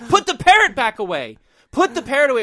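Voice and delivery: high, loud inner panic; screaming it in his head; frantic inner plea. Retold or imitated. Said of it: "I'm not saying this, but I'm thinking".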